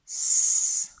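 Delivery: "S" as a loud, long hiss; isolated sound. Shown as a demonstration. Teacher isolates the first sound, "S" hissed loudly. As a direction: hiss "S" as sss, not the letter name. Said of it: "on entend".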